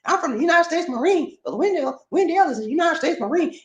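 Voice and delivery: high, cartoonish character greeting; my silly Gong Pao character voice. Shown as a demonstration. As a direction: pitch way up and play the character. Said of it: "Gong Pao voice".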